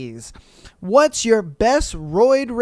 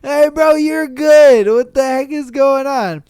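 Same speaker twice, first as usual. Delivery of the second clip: loud higher call to a buddy; 4 semitones higher, 7 dB louder than usual. Retold or imitated. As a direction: shout it across the gym. Addressing the screaming guy.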